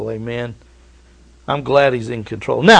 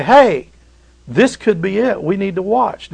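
watchman calling out; 7 semitones higher, 3 dB louder than usual. Acted. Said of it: "and we say".

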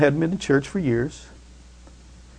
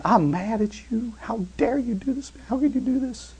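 muted anger at God; 9 semitones higher, 6 dB quieter than usual. Retold or imitated. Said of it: "We want to say".

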